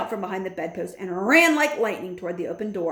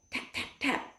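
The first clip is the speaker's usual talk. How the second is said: soft unvoiced knocking sounds; whispered taps; 6 semitones higher, 9 dB quieter than usual. A narrator's reading.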